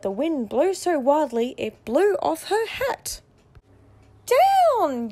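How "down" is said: high, loud call of down; a fairy's sharp high yell. A narrator's reading. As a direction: shout it as the broom drops. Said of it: "yelled the fairy".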